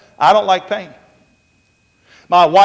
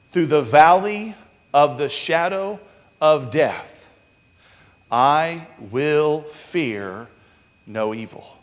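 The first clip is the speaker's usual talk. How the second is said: slow, measured verse with long pauses; scripture reading; 3 semitones lower, slower than usual. Acted. Preacher reciting David's psalm to the congregation, slow and solemn. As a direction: let each phrase fall low and settle.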